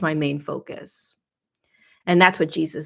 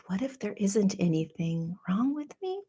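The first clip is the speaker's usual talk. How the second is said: whispered question; 3 semitones higher, 7 dB quieter than usual. Retold or imitated. Quoting her own quiet question.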